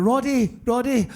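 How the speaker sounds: gently trying to wake a sleeping man; gentle call. Retold or imitated. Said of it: "Everyone's been so gentle about".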